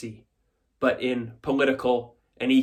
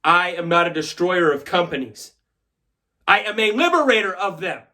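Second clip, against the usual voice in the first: raised voice, climbing higher on the second sentence; emphatic declaration; 4 semitones higher, 4 dB louder than usual. Acted. Shareholder defends his record to stockholders, emphatic. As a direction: proclaim it to the whole hall.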